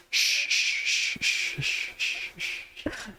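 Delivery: long run of shushes, dying away; shushing. Acted. Game master voices hushing after the yell, shushing. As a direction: hush over and over, fading out.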